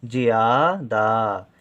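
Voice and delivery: "ज्यादा" very slowly, both aa vowels held; drawn-out vowels. Shown as a demonstration. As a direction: stretch each syllable of "ज्यादा". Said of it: "ज्या देर तक हो रहा है". ("ज्यादा" के दोनों आ देर तक खींचकर)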